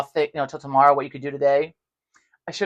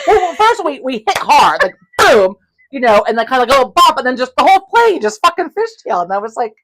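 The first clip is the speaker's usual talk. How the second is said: ordinary delivery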